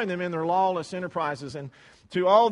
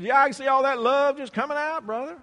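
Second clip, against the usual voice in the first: loud, higher mock-admiring reply; sarcastic; 7 semitones higher, 6 dB louder than usual. Preacher retelling his sarcastic reply to the angry churchgoer. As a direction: lay the irony on thick.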